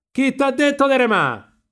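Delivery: high, loud, stretched-out yell; shouted challenge. A narrator's reading. Riccetto confronts the rower, high loud shout. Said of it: "gli gridò il Riccetto".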